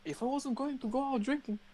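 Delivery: mock threat. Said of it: "I was like".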